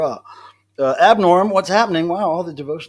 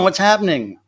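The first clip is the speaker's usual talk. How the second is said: usual speaking voice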